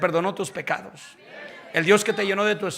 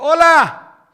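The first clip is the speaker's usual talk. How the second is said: curt greeting; 8 semitones higher, 14 dB louder than usual. Retold or imitated. Curt greeting to old friends.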